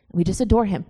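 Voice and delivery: normal talking voice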